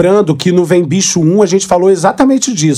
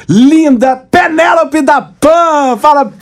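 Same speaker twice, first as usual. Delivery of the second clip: excited introduction; 8 semitones higher, much slower than usual. Real, not acted. Host announces guest.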